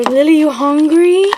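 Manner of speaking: high, loud doggy call. Real, not acted. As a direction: call the dog brightly. Calling the dog to eat.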